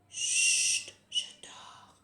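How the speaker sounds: hushed sleepy thumb; sleepy whisper. Pretend. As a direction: whisper like you're half asleep. Sleepy thumb character answers.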